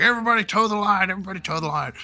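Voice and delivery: imitated commander calling formation; commander ordering. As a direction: bark the order at the whole bay. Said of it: "he's like".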